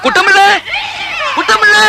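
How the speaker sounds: urgent shout. Acted. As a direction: yell for the servant to come. Father shouts for servant.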